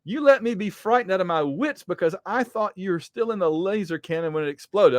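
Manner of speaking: high, hammed-up reproach; upset scolding. Jan scolds Hank after fearing him dead, mock upset.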